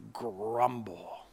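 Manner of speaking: voiced grumble, whispered low; grumbling whisper. Reading crowd grumbling from scripture.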